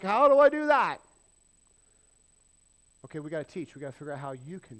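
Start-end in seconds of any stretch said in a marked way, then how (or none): 0.0-1.0 much higher and louder, baffled question; confused guy. Pretend. Legless man asking how to dig, loud and high-pitched. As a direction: cry out like a man lost on the task. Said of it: "the guy's like".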